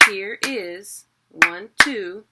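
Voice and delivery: HERE IS in two beats, then ONE, TWO punched loud; counting out syllables. Shown as a demonstration. Teacher counting syllables for learners, HERE IS, ONE, TWO, beat by beat. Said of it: "that's two syllables".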